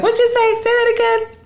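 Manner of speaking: very high-pitched imitation of a listener; put-on high voice, nosy; mocking listener. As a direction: mimic someone pouncing on an accent, way up high. Mimicking people who notice her accent.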